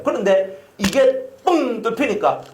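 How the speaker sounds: usual speaking voice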